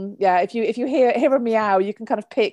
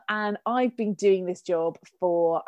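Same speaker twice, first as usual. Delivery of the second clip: ordinary delivery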